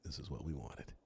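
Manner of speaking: low, hushed imitation; quiet confiding aside. Lawyer looking over at client in court, quiet aside. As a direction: murmur it as if passing a secret satisfaction.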